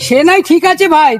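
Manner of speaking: normal talking voice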